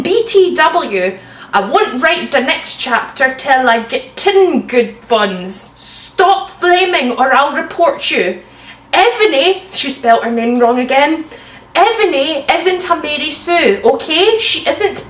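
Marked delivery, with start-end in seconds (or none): none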